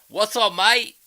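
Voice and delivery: very high, loud mock-Aussie greeting; bad Australian accent. Imagining greeting Australians over there, bad Australian accent. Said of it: "I suck at an Australian accent".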